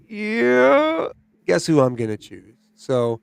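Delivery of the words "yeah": high, stretched rising "yeah"; eager yeah. Retold or imitated. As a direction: sound like someone bursting to share. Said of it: "they're just like".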